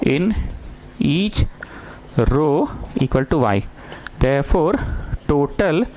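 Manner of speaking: slow, word-by-word dictation with long gaps; teacher dictating notes to the class, slow and spaced. Acted. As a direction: spell it out slowly for students to copy.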